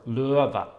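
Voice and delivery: LØVE in the coastal tone pattern, ending on a fall; Tromsø melody. Shown as a demonstration. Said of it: "in Tromsø".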